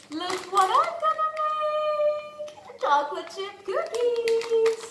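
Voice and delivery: high, slow, sing-song showing off; big excited announcement. Acted. Excited cooking intro.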